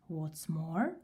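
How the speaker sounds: plain reading voice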